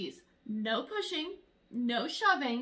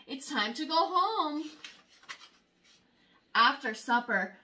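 plain reading voice

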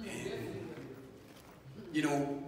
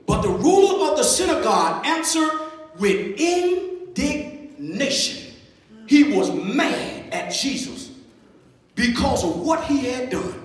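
high, raised pulpit voice; emphatic preaching; 7 semitones higher, 7 dB louder than usual. Real, not acted. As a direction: preach the verse forcefully, pausing between phrases. Preaches and reads scripture forcefully.

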